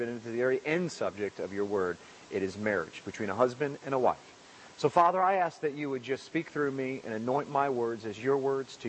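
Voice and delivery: low, hushed prayer voice. Acted. Pastor opening the sermon in prayer, low and reverent.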